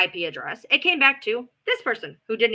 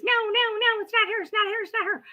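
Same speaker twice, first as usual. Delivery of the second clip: high-pitched panicky "no, no, no" as Chocolate Sunrise; high mocking denial; 11 semitones higher than usual. Vlogger imitating Chocolate Sunrise denying it, high and frantic. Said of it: "and Chocolate Sunrise says".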